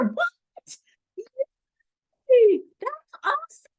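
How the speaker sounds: high, loud squeal of delight; excited squeal. Real, not acted. Friend hears she's invited back to speak, excited squeal. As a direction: cheer for the news with a real yelp.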